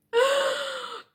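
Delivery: high startled gasp; a sudden high gasp of shock; sharp gasp. Retold or imitated. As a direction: suck in air, caught red-handed. Dad calls her name.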